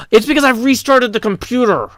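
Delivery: high, gasped outburst of realisation; gasp, then blurt it out high; alarmed realisation. Real, not acted. Streamer realising why the mic is peaking, real dismay.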